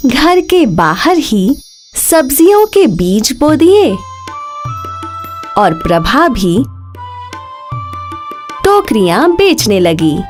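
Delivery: ordinary delivery, nothing marked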